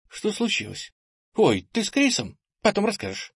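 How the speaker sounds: high-pitched, light-volume woman's voice; female character voice. A narrator's reading. Ira questions the returning boy, high female voice.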